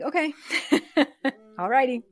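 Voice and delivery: bright mock cheer, with a laugh; sarcastic okay. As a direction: brush it off sarcastically. Sarcastic reply to cruel remark.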